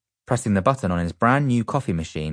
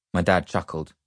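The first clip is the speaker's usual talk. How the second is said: ordinary delivery, nothing marked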